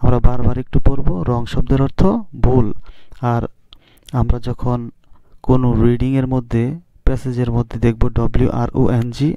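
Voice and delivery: ordinary talk, nothing marked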